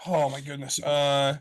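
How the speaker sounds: usual speaking voice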